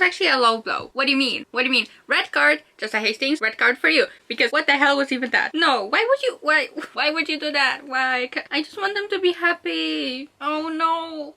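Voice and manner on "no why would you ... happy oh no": high, pained wail of protest; devastated whining. Real, not acted. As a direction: plead helplessly for the characters' happiness. Devastated by the chapter.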